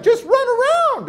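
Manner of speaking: very high, loud imitated urging; hammed-up exasperation; exasperated urging. Exasperated man urging the kid.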